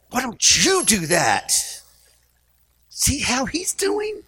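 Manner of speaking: high, slow mock nagging wife; hammed-up nagging wife voice. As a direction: whine at him like a jealous wife. Wife nagging husband in retold scene.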